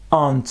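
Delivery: "Ant" said as one syllable, no R sound. Shown as a demonstration. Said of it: "Elle se prononce ant".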